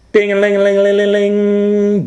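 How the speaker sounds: high, loud ringing bell; phone ringing. A narrator's reading. The fire station telephone ringing, high loud ring.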